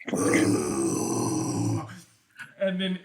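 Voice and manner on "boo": loud, much lower crowd roar; deep-voiced mob booming back; crowd response. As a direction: answer like the whole warband at once. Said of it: "everybody is like".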